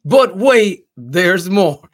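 loud, high pitchman voice; infomercial. Retold or imitated. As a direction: sell it like a TV pitchman. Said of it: "that Sham Wow".

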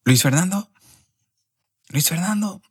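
high call of a name across the room. Retold or imitated. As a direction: call him like an official summoning. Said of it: "de la embajada y grita". (una empleada de la embajada llama en voz alta al siguiente)

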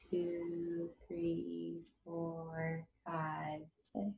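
counting that fades to very soft; hushed breath count. Real, not acted. Counting breaths, fading into silence.

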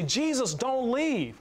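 high, pleading cry; anxious plea. Retold or imitated. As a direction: beg him anxiously not to leave. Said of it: "I get anxious and I say".

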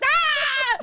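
loud high scream; loud high falling scream; retold scream. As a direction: scream as you fall. Being dropped, screaming.

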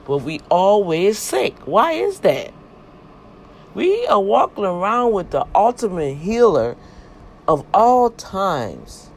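rising loud, high preacher's build; preaching at full force. Acted. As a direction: build it up like a Sunday sermon.

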